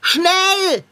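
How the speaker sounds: high loud "Schnell!"; urgent shout. Acted. Cat urging the owner, loud and frantic. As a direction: snap out the hurry.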